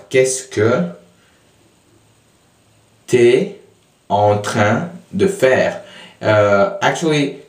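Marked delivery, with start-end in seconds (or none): 0.1-5.8 "Qu'est-ce que" then a long pause before "t'es"; slow, segmented teaching read; broken down slowly. Shown as a demonstration. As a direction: split the question into slow chunks. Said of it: "I break it down".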